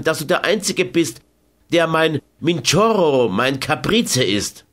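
bristle, then soften into flattery; Carmen, defiant then coaxing. A narrator's reading.

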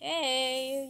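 normal talking voice

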